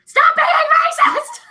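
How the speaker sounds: loud, high, drawn-out shout; mock yell. Shown as a demonstration. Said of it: "yell it louder".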